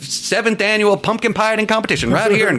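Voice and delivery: high Southern announcer with a lisp; slushy lisp. Retold or imitated. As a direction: yell it like a hammy country host. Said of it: "lateral lisp where I'm yelling at people like".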